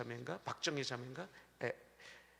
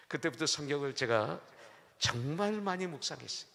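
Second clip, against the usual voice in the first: ordinary talk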